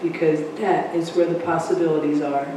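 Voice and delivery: calm spa-style guided relaxation; guided meditation. Acted. So let's get quiet and meditate.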